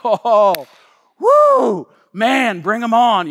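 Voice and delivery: loud, giddy bravado; hyped cheer. Retold or imitated. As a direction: whoop like a cocky teenager. Hyped about star teammate.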